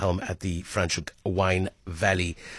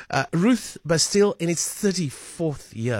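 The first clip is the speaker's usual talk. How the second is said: normal talking voice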